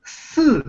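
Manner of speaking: "四" starting high and dropping sharply; falling fourth tone. Shown as a demonstration. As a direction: let the pitch fall through "四". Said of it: "That means the fourth tone".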